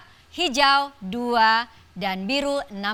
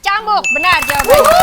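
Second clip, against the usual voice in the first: excited announcer; 7 semitones higher, 11 dB louder than usual. Real, not acted. Host confirms right answer.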